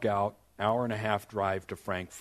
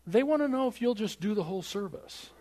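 much higher retold voice; comic escalated request; 11 semitones higher than usual. Friend relays request for whole service, comically high-pitched. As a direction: pitch the big ask way up, for laughs.

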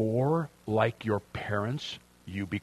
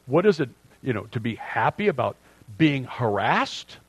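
usual speaking voice

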